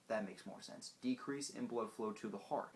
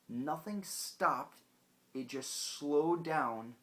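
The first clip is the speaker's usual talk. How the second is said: slow emphasis; 4 semitones higher, 4 dB louder, much slower than usual. Real, not acted. Emphatic explanation.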